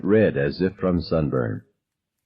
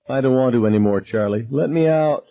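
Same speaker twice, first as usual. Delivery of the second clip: tearful plea; 6 semitones higher than usual. A narrator's reading. Irma begging through tears.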